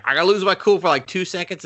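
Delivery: high, mocking put-on voice. Fisk explaining his plan, high mocking voice. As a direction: poke fun at the villain in a squeaky voice. Fisk's retold line.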